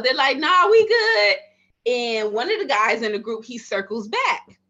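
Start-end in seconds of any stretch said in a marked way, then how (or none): 0.4-1.4 loud casual brush-off. Retold or imitated. Customers brushing her off.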